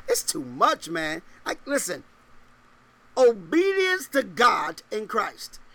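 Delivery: ordinary delivery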